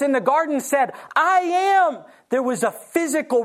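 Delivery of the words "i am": much higher and louder I am; powerful proclamation. Acted. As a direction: ring it out with divine power. Jesus proclaims, soldiers fall back.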